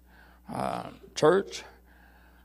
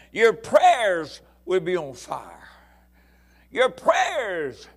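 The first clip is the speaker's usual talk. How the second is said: loud, high, building sermon; fiery preaching; 6 semitones higher, 9 dB louder than usual. Real, not acted. Preacher urging the congregation about hearts on fire, loud and fervent. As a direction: preach it full force with fire.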